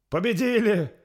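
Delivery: high, loud, drawn-out cheering; victory cries. A narrator's reading. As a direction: shout the triumph across the battlefield. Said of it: "раздались крики вокруг". (победный протяжный крик)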